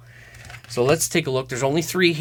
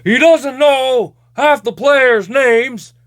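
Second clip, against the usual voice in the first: higher, louder hockey commentator griping; Don Cherry impression; 10 semitones higher, 6 dB louder than usual. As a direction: rant like a fired-up TV hockey pundit. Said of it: "I'd love to have Don Cherry".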